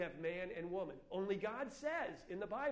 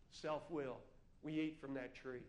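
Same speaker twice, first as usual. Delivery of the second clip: ordinary delivery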